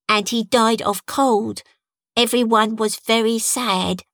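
put-on a proud little boy explaining. A narrator's reading. Toby tells his story.

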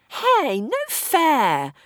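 high, loud mock outrage; indignant protest. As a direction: whine like a cheated kid.

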